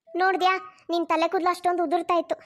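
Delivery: ordinary talk, nothing marked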